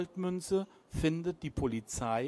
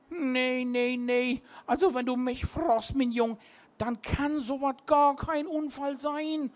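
put-on higher, louder tipsy old seaman; slurring captain; 8 semitones higher, 4 dB louder than usual. A narrator's reading. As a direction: slur it like a drunk skipper. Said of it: "lallte der Captain".

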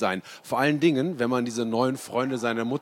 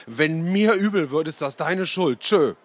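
louder, higher parting shout from the bus; calling back; 5 semitones higher, 6 dB louder than usual. A narrator's reading. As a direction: fling the last word at mom. (der Junge ruft der Mutter trotzig zu)

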